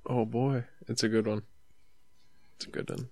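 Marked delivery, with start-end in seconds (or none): 2.6-3.1 mock whisper, much softer; whispered repeat. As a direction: breathe it again as a sly aside. Repeats the line as an aside.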